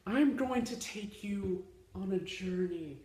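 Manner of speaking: soft, solemn dramatic narration; hushed storyteller. Acted. Storyteller leading the audience into the past, hushed and solemn. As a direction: draw them in quietly. Restarts the announcement after laughter.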